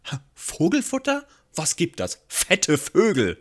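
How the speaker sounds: hoch einsetzender, gedehnter Bankdirektor; spöttisch, zum Schluss langsam und laut; Bankdirektor-Stimme. Retold or imitated. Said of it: "Und der Bankfilialleiter sagt dann". (gespielter Bankdirektor aus Mary Poppins)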